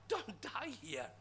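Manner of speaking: alarmed plea, half laughing; hammed-up startled protest; mock alarm. Alarmed listener reacts to visitor.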